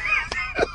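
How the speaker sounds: cracking up, high-pitched; squealing amused laughter; high helpless laugh. Real, not acted. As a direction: burst out laughing at your own joke. Cracks up at own imitation.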